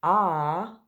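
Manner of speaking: loud open "Ah" for octopus; short o sound. Shown as a demonstration. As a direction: stretch the jaw open on "Ah". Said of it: "we hear the difference".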